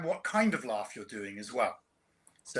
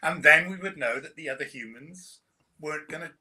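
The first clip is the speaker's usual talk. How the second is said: ordinary talk, nothing marked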